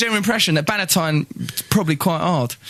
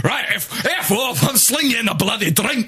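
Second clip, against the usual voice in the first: angry sailor; 4 semitones higher than usual. Acted. Acted angry sailor outburst.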